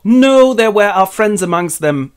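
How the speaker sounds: high, loud cry of dismay; mock anguish. Aragorn learns all were killed, high anguished cry. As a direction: wail over lost friends.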